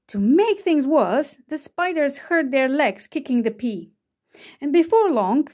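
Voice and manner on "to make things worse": ordinary delivery, nothing marked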